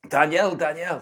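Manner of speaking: calling out his name loud and high; urgent mock yelling of a name; retold scream. Woman calling out to the priest at dawn, urgent yelling. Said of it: "She started screaming".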